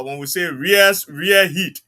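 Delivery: ordinary talk, nothing marked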